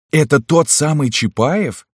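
high astonished question; surprised. A narrator's reading. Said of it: "спросил Гаузе".

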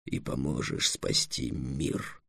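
low, very slow, soft pronouncement; solemn. Acted. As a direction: finish the proclamation with quiet gravity. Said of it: "торжественно сказал Эллен". (торжественно, тихо, низким голосом)

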